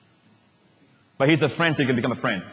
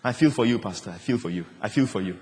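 sympathetic friend; 6 semitones lower, 5 dB quieter than usual. Retold or imitated. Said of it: "and they say".

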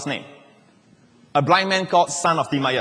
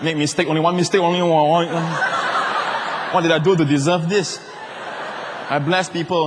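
mock self-pity. Mock complaint over his slip.

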